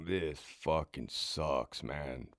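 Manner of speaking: slow, low, quiet grumble; muttering to himself on the job; grumbling. Retold or imitated. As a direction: sulk it under the breath. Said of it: "And I'm like".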